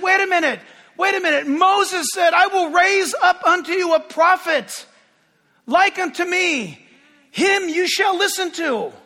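excited pleading. Retold or imitated. Retelling own excited reply.

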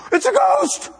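high, loud scream of a ghost; panicked shriek, pitched high and loud; terrified shout. Retold or imitated. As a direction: yell it like you just saw a spirit. Disciples shouting in fear.

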